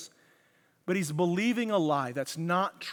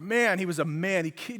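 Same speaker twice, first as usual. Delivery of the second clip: ordinary delivery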